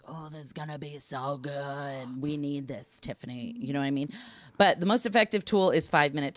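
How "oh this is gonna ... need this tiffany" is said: excited self-talk. Retold or imitated. Guest talking to herself before a bubble bath, softly excited. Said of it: "I get excited sometimes, and I'm like".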